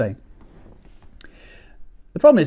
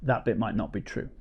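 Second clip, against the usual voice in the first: much softer throwaway; quiet aside; 5 semitones lower, 11 dB quieter than usual. Acted. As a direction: drop the voice for a sly aside. Muttered aside after joke.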